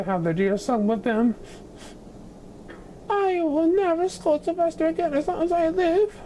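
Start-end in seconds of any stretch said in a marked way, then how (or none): 3.1-6.2 high, tearful vow; a grieving mother promising aloud; mother's voice. A narrator's reading. Mrs Duncan, missing her son, vowing never to scold. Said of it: "said Miss Duncan".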